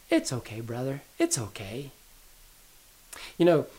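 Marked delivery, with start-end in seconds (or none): 0.1-1.9 softer, tender little-brother comfort; sweet reassurance. Retold or imitated. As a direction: soothe your big brother sweetly. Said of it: "Andrew was just being so sweet".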